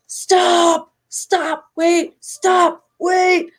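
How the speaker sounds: high shouted stop calls; high urgent retold shouts; calling out. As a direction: flag down the bikers, yelling. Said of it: "doing all the motions".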